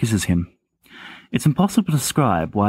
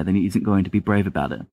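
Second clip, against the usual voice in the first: usual speaking voice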